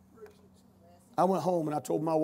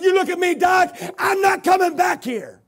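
defiant declaration; 10 semitones higher, 6 dB louder than usual. Retold or imitated. Patient defiantly telling the doctor he won't return. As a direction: plant your feet and declare it.

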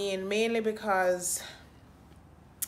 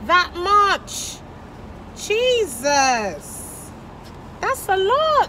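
high, loud disbelief at price; shocked; 13 semitones higher, 10 dB louder, much slower than usual. Real, not acted. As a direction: gasp it out in shock.

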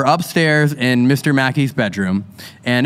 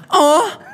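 high mock moan; short high-pitched moan, put on; imitated moan; 12 semitones higher than usual. Retelling the listener's report to the audience, imitated moan. Acting out moan heard on headset.

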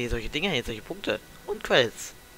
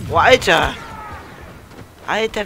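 loud frustrated exclamation; loud outburst; 6 semitones higher, 10 dB louder, slower than usual. Real, not acted. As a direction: blurt it out loudly. Sudden loud outburst in game.

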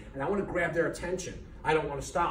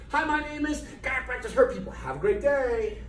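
higher, louder, bright salesman-like hello; mock cheery greeting; 9 semitones higher, 4 dB louder than usual. Chiropractor greeting farmers market passersby, higher and cheery. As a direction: greet strangers at a booth with forced cheer. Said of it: "stand there in screenings and say".

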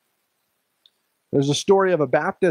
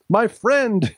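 higher-pitched, affronted echo; indignant exclamation; 7 semitones higher, 3 dB louder than usual. A narrator's reading. Old preacher answering General Washington, indignant exclamation. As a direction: exclaim it in sudden disbelief. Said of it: "exclaimed the old preacher".

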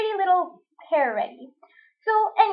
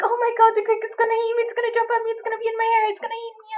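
high, rushed retold panic that fades out; hammed-up terror of the cricket; panicky; 7 semitones higher, much faster than usual. Alone in the shower, panicking aloud about the cricket, pitched high. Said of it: "really panicky".

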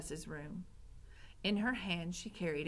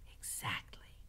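soft, whispered rat voice; hushed agreement; 8 dB quieter than usual. A narrator's reading. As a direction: whisper it like a schemer.

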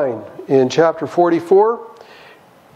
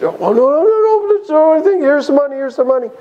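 panicked brothers; 6 semitones higher, faster than usual. Acted. Guilty brothers insist they return the money, panicked. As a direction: plead in a rush, voice shooting up.

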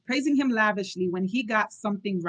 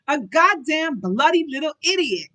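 loud, high angry outburst; roaring; 6 semitones higher, 7 dB louder than usual. A narrator's reading. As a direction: interrupt with an exasperated roar. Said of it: "Hastings roared".

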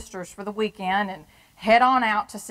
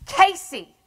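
sudden outcry; 9 semitones higher, 6 dB louder than usual. Acted. Sudden outburst naming husband.

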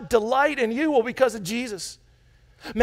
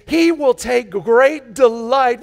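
slow emphasis; 5 dB louder, much slower than usual. Shown as a demonstration. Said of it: "slowed scripture reading".

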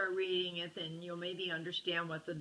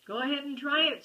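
loud taunt; 7 semitones higher, 9 dB louder than usual. Acted. Bully taunts Brother.

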